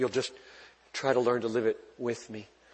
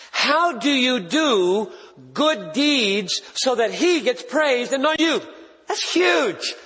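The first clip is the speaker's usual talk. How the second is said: higher, louder, slowed-down pulpit delivery; preaching at full force; 9 semitones higher, 4 dB louder, slower than usual. Acted. Pastor pressing the crowd on verse sixteen, preaching at full force. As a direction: drive each phrase home to the congregation.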